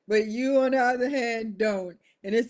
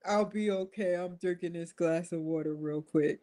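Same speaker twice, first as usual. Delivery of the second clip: much softer, murmured assurance; mock quiet reassurance; 3 semitones lower, 8 dB quieter than usual. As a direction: brush it off softly. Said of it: "Biden reassuring aides".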